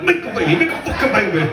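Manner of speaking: high, forceful comic shouting; urgent put-on shout; mock bedroom yell. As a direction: bark it as a hammed-up order. Acts out bedroom shouting while choking.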